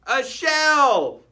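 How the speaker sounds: loud, high announcement; excited reveal. A narrator's reading. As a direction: reveal it with a flourish. Reveal to listeners.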